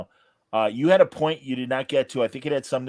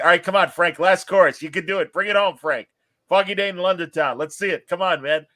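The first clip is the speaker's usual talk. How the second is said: raised, loud ballgame cheering; root for him from the stands; cheering like a fan; 6 semitones higher, 4 dB louder than usual. Retold or imitated. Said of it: "watching it like it's a ball game".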